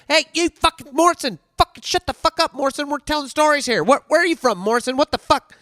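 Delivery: high squeaky yelling impression of the petty officer; squeaky-voiced Spencer. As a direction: squeak out the chewing-out like a cartoon sailor. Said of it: "one of those little squeaky voices".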